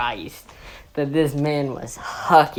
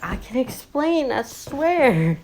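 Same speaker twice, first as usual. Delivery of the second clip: high-pitched mock pleading; 8 semitones higher than usual. Student explaining himself to his teacher, pleading.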